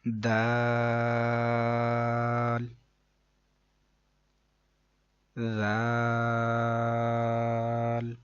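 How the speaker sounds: دال and ذال each with a very long level aa; elongated letter names. Shown as a demonstration. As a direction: stretch the aa of دال and ذال evenly. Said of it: "تین second لمبا کریں گے". (دال، ذال کو لمبا کھینچ کر)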